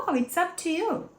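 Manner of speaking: a light, offhand "whatever you want"; casual. Shown as a demonstration. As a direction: shrug it off lightly. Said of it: "Você pode falar isso de boa". (falando de boa, despreocupado)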